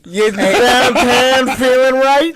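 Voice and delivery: put-on strut it out in a Pittsburgh accent; Yinzer pickup line. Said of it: "pickup line in a Pittsburgh accent".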